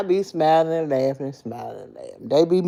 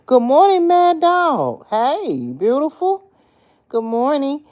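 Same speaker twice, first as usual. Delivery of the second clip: bright singsong hello, much higher; cheery greeting; 10 semitones higher, 4 dB louder, slower than usual. Retold or imitated. Greeting coworkers at the job, high and cheery. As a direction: sing out a big morning hello.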